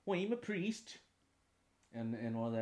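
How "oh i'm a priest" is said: put-on high, lively Cork-accented priest. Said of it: "a Cork accent".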